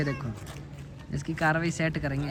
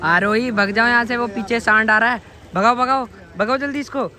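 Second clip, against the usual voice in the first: yelling at her to run, higher and louder; urgent shout; 6 semitones higher, 5 dB louder than usual. Real, not acted. Uncle shouts to Arohi to flee the bull, real alarm. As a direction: shout a warning across the yard.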